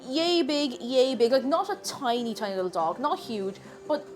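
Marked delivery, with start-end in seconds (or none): none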